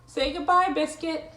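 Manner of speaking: high, loud storybook voice; cheery prompting. Acted. Owner telling puppy to say goodbye, high and cheery.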